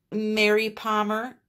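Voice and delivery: real New England accent, R dropped in Palmer; unforced New England accent slipping into the name. Real, not acted. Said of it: "New England accent kicked in there".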